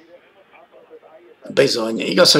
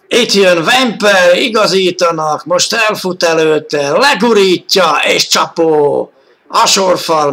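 excited hungarian sports commentary; 5 semitones higher, 4 dB louder than usual. Real, not acted. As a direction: relive the goal with energy. Replay narrated, still elevated.

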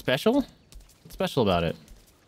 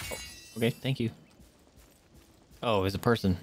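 ordinary talk

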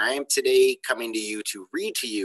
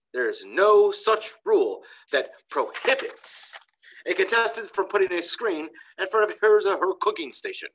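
declaring; 10 semitones higher, 4 dB louder, slower than usual. Acted. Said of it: "he declared".

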